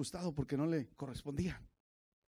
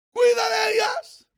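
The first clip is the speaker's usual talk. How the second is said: sudden high, loud outburst; preacher's shout; 13 dB louder, slower than usual. Real, not acted. Preacher finishing a Bible verse, shouted. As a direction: burst into a shouted sermon line.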